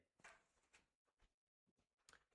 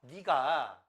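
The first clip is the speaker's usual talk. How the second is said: normal talking voice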